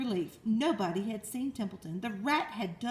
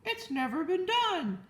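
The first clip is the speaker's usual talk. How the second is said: high, ringing public-address call; loudspeaker announcer; 8 semitones higher than usual. Acted. Loudspeaker addressing the fair crowd, grand announcer. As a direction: hail the whole fairground. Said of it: "said the loudspeaker".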